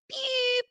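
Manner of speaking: high squeaky "Pew!"; a tiny high zap, mocking; mock whistle noise. Mocking Messi going down.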